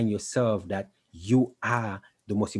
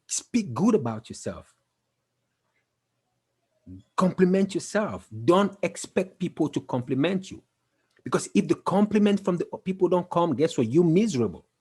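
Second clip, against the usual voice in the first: fired-up exhortation; 6 semitones higher, 4 dB louder than usual. Real, not acted. Exhorting viewers.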